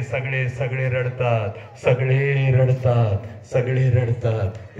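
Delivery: slow, drawn-out repetition; subdued lament. Acted. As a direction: let each phrase sink and linger. Same words dropped low, slow.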